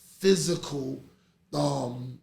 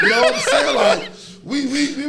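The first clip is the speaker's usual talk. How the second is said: laughing; 9 semitones higher, 10 dB louder than usual. Real, not acted. Laughing while retelling the story.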